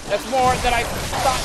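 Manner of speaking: sudden loud, high, slowed cry of disbelief; shocked outburst. Real, not acted. Player stunned by what he lost, loud shocked cry. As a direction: blurt it out, stunned by the loss.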